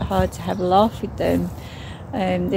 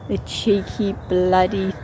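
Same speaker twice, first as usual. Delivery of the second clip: normal talking voice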